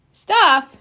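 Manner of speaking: a loud, high one-word startled question; surprised. A narrator's reading. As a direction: blurt it out, caught off guard. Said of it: "said Brother".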